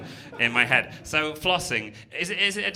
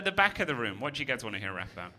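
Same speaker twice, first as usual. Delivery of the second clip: ordinary talk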